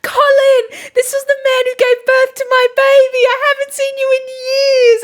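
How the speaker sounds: high, loud hammed-up weepy gush. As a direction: overdo a teary reunion. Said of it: "she's like".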